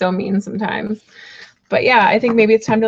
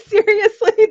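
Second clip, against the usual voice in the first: ordinary delivery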